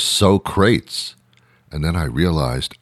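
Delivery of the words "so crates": Socrates said as "SO-crates"; Socrates mispronounced. Retold or imitated. Baffled viewer asking what it is, Socrates mispronounced as "so-crates". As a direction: question the word Socrates as "so-crates", two syllables. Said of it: "mispronounced Socrates".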